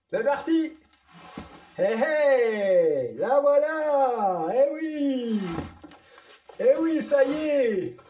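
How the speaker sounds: triumphant reveal of the new camera; thrilled. Real, not acted. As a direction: savour the big reveal triumphantly. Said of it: "tellement content".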